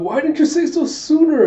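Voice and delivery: high, loud mock shriek; flustered outburst. Princess hearing late news of her visitor, flustered shout. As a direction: burst out in high-pitched exasperation.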